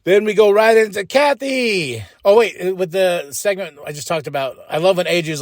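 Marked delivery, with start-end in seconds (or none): none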